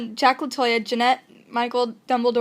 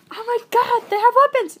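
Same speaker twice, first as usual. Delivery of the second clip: high, louder mock-shocked cry; mock alarm; 11 semitones higher, 5 dB louder than usual. Silly people mistaking grass for weapons, mock alarm. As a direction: exclaim in exaggerated panic, pitched way up. Mocking others' panicked reaction to grass.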